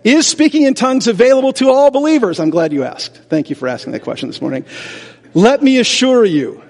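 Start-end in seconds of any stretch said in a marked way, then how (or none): none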